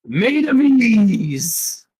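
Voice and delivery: drawn-out stand announcing itself; stand's introduction voice. Acted. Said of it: "And goes".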